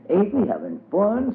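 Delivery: put-on high, happy little girl's cry; bubbling over with fun; gleeful child. A narrator's reading. Dubs delights in the mud kitchen, high gleeful child voice.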